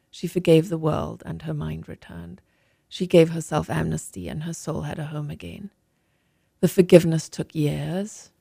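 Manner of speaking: steady, reflective reading aloud from her book; memoir reading. Acted. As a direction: let the landscape and grief unfold calmly. Author reads from her book.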